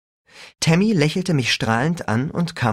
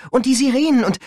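getting worked up; 12 semitones higher, 4 dB louder, faster than usual. A narrator's reading. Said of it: "sie kam in Fahrt".

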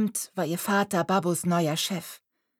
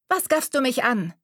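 sharp, louder, higher snap; snapping at her; 7 semitones higher, 5 dB louder than usual. A narrator's reading. Haughty girl snaps at Mariella for staring. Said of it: "fuhr sie Mariella an".

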